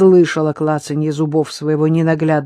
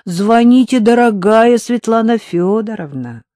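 любезно; 6 semitones higher, 7 dB louder than usual. Acted. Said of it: "Рита была сама любезность".

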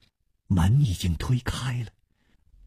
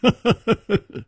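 short high chuckle; light chuckle; 11 semitones higher than usual. A narrator's reading. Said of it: "楚留香微微一笑说". (轻声一笑)

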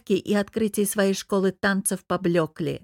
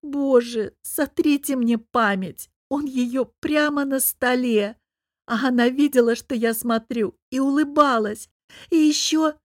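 wailing; 5 semitones higher, 3 dB louder than usual. A narrator's reading. Heartbroken wife wailing to her friend about the betrayal. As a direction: howl it into your hands. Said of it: "всхлипнула и, закрыв лицо руками, провыла".

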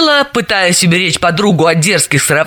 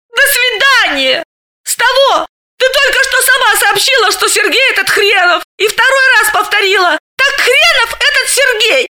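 laughing. Acted. Galina laughing at the name.

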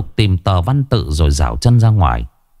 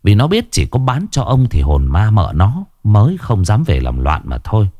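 ordinary delivery

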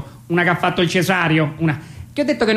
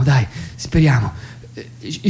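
normal talking voice

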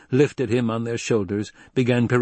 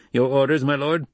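lightly voiced subordinate, higher pitch; deferential guard captain; 5 semitones higher, 3 dB louder than usual. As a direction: ask your superior for orders, pitched up. Guard captain asks count for orders.

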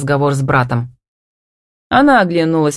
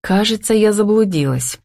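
usual speaking voice

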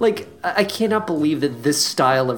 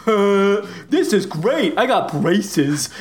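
put-on higher-pitched dopey kid voice, slowing at the end; dopey Eli; 6 semitones higher, slower than usual. Recapping the movie, mocking dim friend Eli in a higher dopey voice. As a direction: play him as a gormless mouth-breather, thrilled. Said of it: "And Eli's like".

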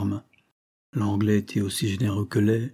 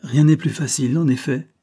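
put-on higher, louder repeat with no accent; lightly mocking echo; 5 semitones higher, 6 dB louder than usual. A narrator's reading. Young woman repeating the Englishman's words, lightly mocking. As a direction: echo him with a touch of banter. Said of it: "au ton gouailleur de Soleil Couchant".

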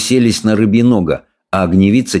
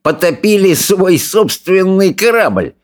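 sneering, sing-song bully, pitched way up; jeering bully; 11 semitones higher than usual. A narrator's reading. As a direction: taunt the others with relish.

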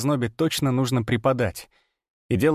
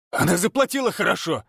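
bark; 7 semitones higher than usual. A narrator's reading. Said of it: "рявкнул Фёдор в ответ".

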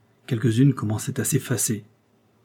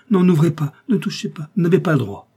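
high, loud acted protest; protesting cry; 6 semitones higher, 5 dB louder than usual. A narrator's reading. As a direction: cry out to stop them, voice raised. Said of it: "cria Funghetti". (cri de protestation, fort et aigu)

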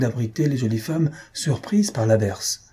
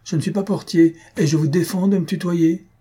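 higher-pitched, indignant outburst; cri indigné; 7 semitones higher, 3 dB louder than usual. A narrator's reading. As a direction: shout the rebuke at a cheeky visitor. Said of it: "et cria". (cri indigné, voix plus haute)

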